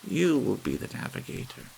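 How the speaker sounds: put-on calmly agreeing old lady; old lady's voice. A narrator's reading. Missus Bellows decides.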